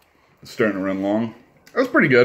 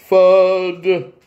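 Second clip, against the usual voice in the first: attempted cartoon voice; 10 semitones higher, 7 dB louder than usual. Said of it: "But I can't do, like, you know, accents".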